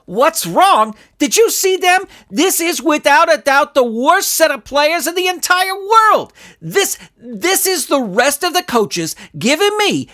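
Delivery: high, loud angry ranting coach; furious rant. Acted. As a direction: vent the frustration at full volume.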